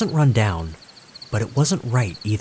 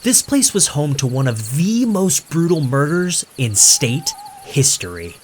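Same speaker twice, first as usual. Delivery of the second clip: showy, slightly higher hype voice; performative confidence; 6 semitones higher, 3 dB louder than usual. A narrator's reading. Streamer selling the murder story to viewers, showy confidence. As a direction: sell the horror like a showman. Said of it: "his voice dripping with the same performative confidence".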